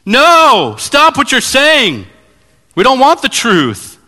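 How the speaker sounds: high, loud, bratty shout; childish mob outcry. Retold or imitated. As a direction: yell it like a kid plugging his ears. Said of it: "plug their ears like a bunch of third graders".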